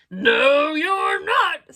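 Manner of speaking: loud, rising troll bellow. A narrator's reading. Troll contradicting the little goat, loud bellow. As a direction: shout it down as the troll. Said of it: "said the troll".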